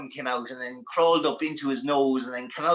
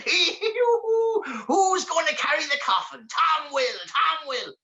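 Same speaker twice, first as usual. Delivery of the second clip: very high gleeful demon squeal; shrill-pitched giddy creature chant; awful excited; 14 semitones higher, slower than usual. Acted. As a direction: whoop and chant like a gleeful imp. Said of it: "started getting awful excited".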